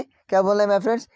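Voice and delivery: ordinary talk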